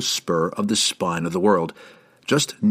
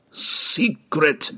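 higher-pitched delirious gasp; gasped word; 5 semitones higher than usual. A narrator's reading. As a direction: gasp it out on the edge of consciousness. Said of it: "Bruner gasped".

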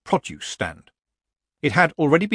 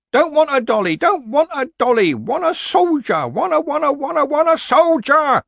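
put-on very high, loud toddler wail; screaming child tantrum; 7 dB louder than usual. A narrator's reading. Small boy refusing a rag doll, screaming tantrum. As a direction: shriek it like a thwarted small child. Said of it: "screaming mouth".

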